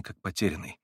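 usual speaking voice

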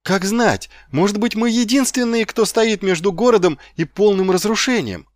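put-on high-pitched, idealistic young recruit; rally them with heroic earnestness; Carrot, fervent. A narrator's reading.

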